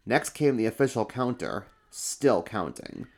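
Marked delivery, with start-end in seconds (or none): none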